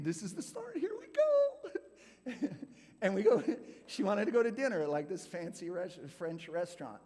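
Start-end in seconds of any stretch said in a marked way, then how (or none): 0.0-2.5 giddy hope. Retold or imitated. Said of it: "I'm thinking".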